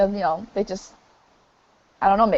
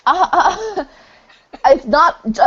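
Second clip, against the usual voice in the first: stifled giggles; 6 semitones higher, slower than usual. Real, not acted. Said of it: "I'm trying not to laugh".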